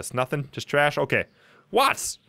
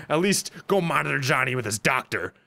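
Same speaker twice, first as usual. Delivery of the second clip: ordinary talk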